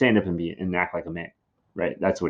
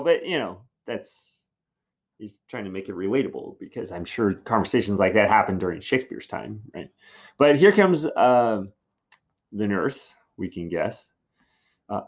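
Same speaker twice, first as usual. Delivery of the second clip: ordinary talk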